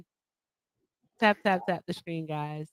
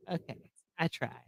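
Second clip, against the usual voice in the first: ordinary talk, nothing marked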